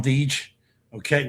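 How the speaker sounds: normal talking voice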